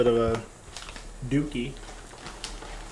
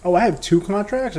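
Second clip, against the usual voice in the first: surprised; 5 semitones higher, 7 dB louder than usual. Real, not acted. Discovers unexpected position.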